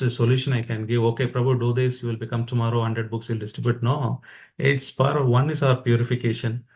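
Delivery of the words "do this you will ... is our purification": ordinary talk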